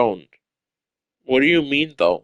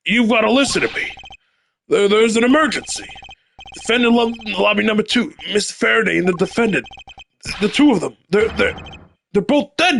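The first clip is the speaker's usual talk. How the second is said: high, urgent, stammering alarm; acted frantic pleading; panicked messenger; 9 semitones higher than usual. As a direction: stumble over the terrible news in a high voice. Messenger bursting in with emergency news.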